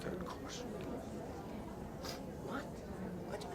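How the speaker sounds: whispered; hushed whisper of the course name. Acted. Skit character whispers.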